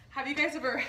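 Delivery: ordinary delivery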